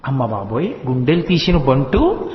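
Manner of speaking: low astonished exclaim, slowing down; amazed gasp. Acted. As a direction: marvel at the child's nerve. Gopika amazed at boy's boldness.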